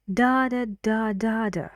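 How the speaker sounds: strong high first "da", then stepping down; rhythm demo. Shown as a demonstration. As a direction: tap out a sentence's stresses on "da". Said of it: "sentence stress and".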